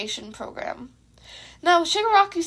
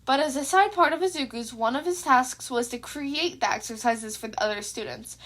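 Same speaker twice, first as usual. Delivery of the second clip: plain reading voice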